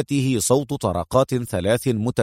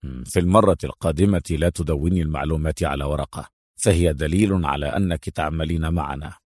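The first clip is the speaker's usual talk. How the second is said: low, restrained reproof; unimpressed superior; 7 semitones lower than usual. A narrator's reading. Adham tells Mona never to write notes, reproving.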